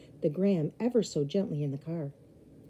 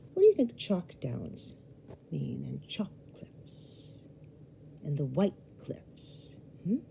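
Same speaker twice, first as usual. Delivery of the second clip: soft slow question; 6 dB quieter, slower than usual. Real, not acted. Reader asks listeners directly.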